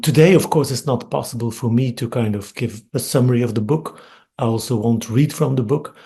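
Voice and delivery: usual speaking voice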